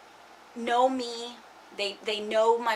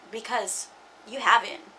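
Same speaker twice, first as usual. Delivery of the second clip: usual speaking voice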